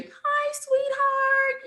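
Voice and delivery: very high, sugary greeting; imitating sweet friend. Voicing best friend Jessa greeting her, high and sweet. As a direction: coo it up high like a doting friend. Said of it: "she's very".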